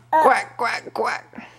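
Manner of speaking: playful quacking, fading to voiceless; duck quacks. Pretend. Parent answers what the duck says, quacking. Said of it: "What does the duck say?".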